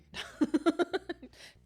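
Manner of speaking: loud, high laugh; amused laughter. Real, not acted. Player cracking up at their own song, laughing loudly.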